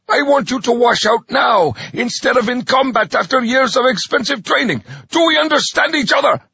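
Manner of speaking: high, loud barking drill sergeant. Acted. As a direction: bark it at the recruits. Drill sergeant addressing recruits.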